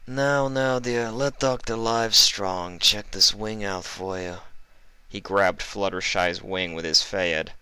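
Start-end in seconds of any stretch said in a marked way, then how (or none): 0.1-4.4 oily, higher mock-kind villain; sly doctor voice. Livestrong taunting the injured Fluttershy, oily and sweet. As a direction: coo falsely sweet like a sadistic doctor.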